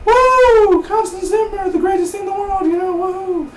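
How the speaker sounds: very high, loud, squealing cheer; mock fan screaming with joy; mock fangirl squeal. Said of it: "you act like".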